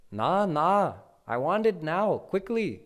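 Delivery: put-on high, loud impatient little boy; child Krishna getting agitated with the aunties. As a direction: stamp it out like an impatient toddler. He's getting more and more agitated.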